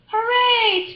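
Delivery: high, loud team cheer; cheering scream. Acted. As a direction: yell it like kids after a pep talk. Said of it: "screamed the dream team".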